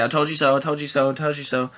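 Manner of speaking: high sing-song told you so; petty gloating. Pretend. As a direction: taunt it like a gleeful kid. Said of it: "the petty side".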